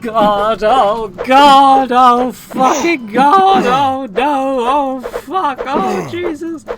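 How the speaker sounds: panicked high yelling; terrified screaming. Real, not acted. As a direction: scream it out in terror. Escalating panic, screaming at threat.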